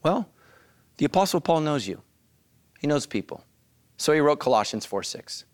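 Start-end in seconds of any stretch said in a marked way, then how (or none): none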